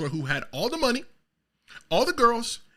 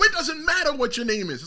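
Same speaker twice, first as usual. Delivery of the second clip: loud, higher mock Rock put-down; The Rock's put-down; 8 semitones higher, 5 dB louder than usual. The Rock cutting off a fan, loud and dismissive. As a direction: deliver the Rock's brush-off big and loud. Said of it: "The Rock will go".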